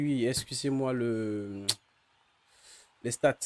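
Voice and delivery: usual speaking voice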